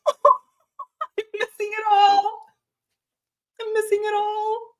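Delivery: slow, high, whiny mock cry; mock wail. Retelling being stuck away from the texts, mock wailing. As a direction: wail over missing the chat, hammed-up. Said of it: "And I'm like".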